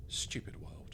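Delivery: whispered, grumbling to himself; muttered curse. Acted. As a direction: breathe it out in disgust. Grumpy hungover muttered curse.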